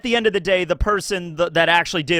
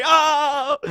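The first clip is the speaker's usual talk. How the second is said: mock cry; 10 semitones higher, 4 dB louder than usual. Cry ending the skit.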